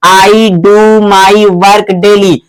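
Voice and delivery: slow, measured English, word by word; slow example reading. Shown as a demonstration. Teacher reading an example to students, slow and deliberate. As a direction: read it out slowly for learners.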